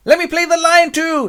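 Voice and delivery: very high, loud squeal; over-eager amateur actor. Acted. Actor begging to play the lion, over-eager. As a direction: clamour for the part at high pitch.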